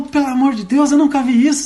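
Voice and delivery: put-on the boy can't believe it; amazed boy. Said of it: "abriu dois olhão".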